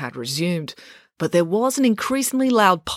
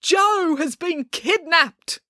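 high, slow, anguished announcement; pleading; 12 semitones higher, much slower than usual. A narrator's reading. Dad tells his children of the kidnapping, pleading. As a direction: draw it out in desperate appeal. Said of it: "Dad pleaded".

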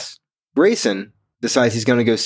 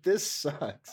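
softer, deflated little grumble; mock mopey mumble; 3 semitones higher, 11 dB quieter than usual. Podcaster voicing sulking Grayson, softly and deflated. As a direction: sulk it under your breath. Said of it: "He's like".

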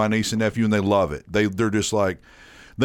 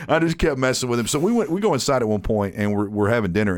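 usual speaking voice

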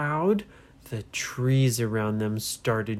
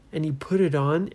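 usual speaking voice

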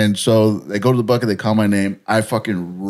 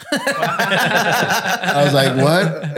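high laugh, then a puzzled what; baffled laugh; 7 semitones higher, much slower than usual. Real, not acted. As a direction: laugh in disbelief at the stranger.

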